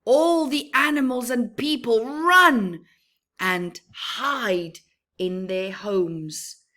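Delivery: dramatic storm telling, softening to darkness at the end; ominous storytelling. A narrator's reading. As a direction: make the sandstorm menacing. Ominous sandstorm passage.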